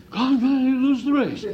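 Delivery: hammed-up jinxed gambler; paranoid bettor. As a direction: play the paranoid punter who's sure he's jinxed. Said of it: "They felt that paranoid".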